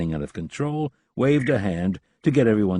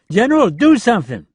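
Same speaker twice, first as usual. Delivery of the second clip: loud, high, urgent appeal; desperate plea; 14 semitones higher, 9 dB louder than usual. A narrator's reading. Leland shouts to the general for help. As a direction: beg the general to act.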